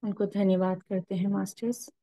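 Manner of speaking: calm, unhurried meditation guide with long pauses; guided meditation. Acted. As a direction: lead the masters gently into stillness and prayer.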